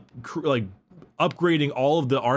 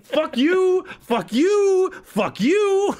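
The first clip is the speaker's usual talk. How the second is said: high, loud triumphant screech; gleeful high-pitched gloating; vindicated shriek; 14 semitones higher, 4 dB louder than usual. Retold or imitated. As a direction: yell like a kid proven right. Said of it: "He's like".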